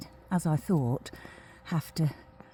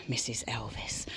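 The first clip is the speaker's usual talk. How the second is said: whispering; 6 semitones lower, 8 dB quieter than usual. Real, not acted. Aside in the van.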